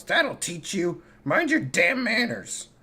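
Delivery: shouting. Acted. Kashan yelling at his dad turned rat, angry shout. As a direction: yell it at the rat. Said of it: "Kashan shouts".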